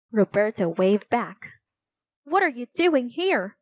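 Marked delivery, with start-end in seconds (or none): 2.2-3.6 high, mild narrated shout; shouted question. A narrator's reading. Older boy to Roberto across the field, mild shout. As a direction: call it over, lightly raised. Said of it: "shouted Miguel".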